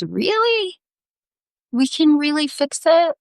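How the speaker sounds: high-pitched, hopeful question; hopeful disbelief. Acted. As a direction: leap up high on a disbelieving "Really?". Penitent voiced, suddenly hopeful.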